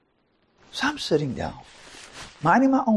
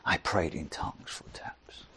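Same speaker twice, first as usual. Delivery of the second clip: soft whispered recollection; hushed whisper; 13 semitones lower, 12 dB quieter than usual. Retold or imitated. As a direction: let it fall to a whisper. Recounting private prayer.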